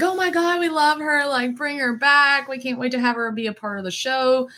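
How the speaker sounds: high, excited imitation of fans; high voice easing down as the praise runs on; gushing audience. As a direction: gush like a thrilled crowd. Acting out a delighted audience.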